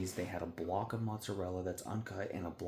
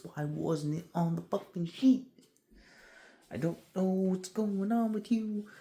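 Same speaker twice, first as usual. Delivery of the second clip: high, loud, drawn-out venting; exasperated outburst; 11 semitones higher, 5 dB louder, slower than usual. Acted. Worker venting at caller about the order sheet, exasperated outburst. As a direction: let frustration push the voice up and stretch each word.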